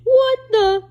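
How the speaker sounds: loud, high startled what the; shocked exclamation. Acted. Scene: reader reacting to the comic's twist, loud shocked cry. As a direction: blurt it in sudden disbelief.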